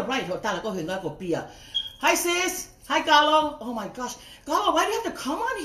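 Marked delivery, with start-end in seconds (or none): 2.0-3.6 calling out hi. Real, not acted. Greeting a viewer joining live.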